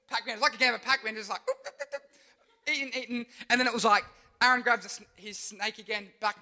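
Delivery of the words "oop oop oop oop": high, soft laughing oops; Pac-Man gobbling. Acted. Preacher laughing through Pac-Man eating sounds.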